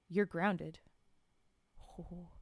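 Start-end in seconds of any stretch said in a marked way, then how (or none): none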